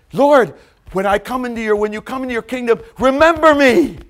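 urgent shout; desperate plea. Acted. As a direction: beg Christ from the cross. Thief pleading.

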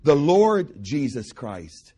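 very slow "the Lord Jesus Christ", each word weighted; emphasize "Lord" slowly; stress on Lord. Shown as a demonstration. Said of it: "He doesn't say Jesus Christ".

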